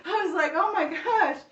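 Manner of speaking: nervous laughter building to oh my gosh; scared giggle. Real, not acted. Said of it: "I was getting kinda scared".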